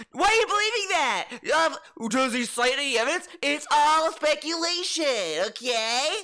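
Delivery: high, whiny mock lecture; mocking DSP voice. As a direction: squeak it out like a know-it-all.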